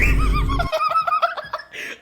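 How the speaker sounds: helpless squealing laughter; loud high-pitched laugh. Real, not acted. Cracks up at own rant.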